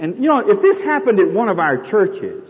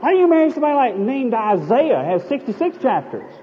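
scolding mother; 7 semitones higher than usual. Acted. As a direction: scold your daughter like a nagging mother-in-law.

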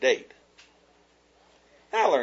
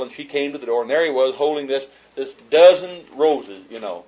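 ordinary delivery

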